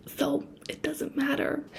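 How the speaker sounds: soft whispered aside; hushed it doesn't matter. Real, not acted. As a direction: breathe it out quietly.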